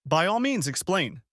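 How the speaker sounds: high, pointed mock courtesy; sarcastic invitation. A narrator's reading. Betrayed husband inviting the cheaters to explain, icily sarcastic.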